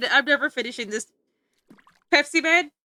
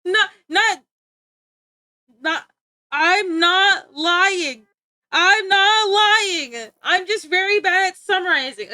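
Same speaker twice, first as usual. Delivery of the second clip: higher and louder, slow drawn-out pleading; whiny protest; 5 semitones higher, 5 dB louder, slower than usual. Real, not acted. Protesting accusation of lying.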